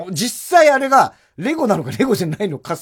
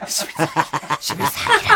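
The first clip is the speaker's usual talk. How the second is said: imitating a whispering comedian; 3 dB quieter than usual. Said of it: "ささやきオールバックが".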